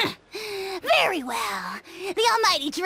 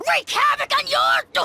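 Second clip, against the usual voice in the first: rising shout; 10 semitones higher, 4 dB louder than usual. Acted. Rant cut off mid-threat.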